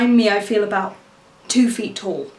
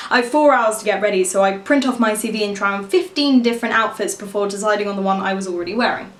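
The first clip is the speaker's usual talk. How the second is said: ordinary delivery, nothing marked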